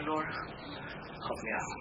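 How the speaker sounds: slow, soft whisper to the Lord; whispered plea. Retold or imitated. Tempted and upset, he whispers to the Lord for help. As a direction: breathe it out as a tired prayer.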